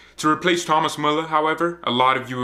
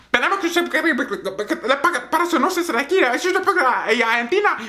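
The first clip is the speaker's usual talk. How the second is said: much higher fake-Spanish gibberish, mock-weepy; mock Spanish crying; 3 dB louder than usual. Messi crying for Argentina, in high mock Spanish. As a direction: babble pretend Spanish like a sobbing caricature. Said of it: "That's what Messi's saying. He's crying".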